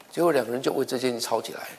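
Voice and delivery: ordinary delivery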